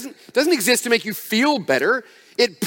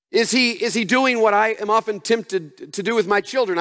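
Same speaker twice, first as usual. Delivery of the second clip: ordinary talk, nothing marked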